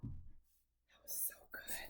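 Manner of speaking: whispered praise; soft whisper. Real, not acted. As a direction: hush it like a secret compliment. Praising child softly.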